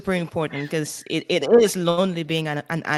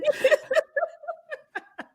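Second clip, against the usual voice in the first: high laugh. Real, not acted. Laughing at impression.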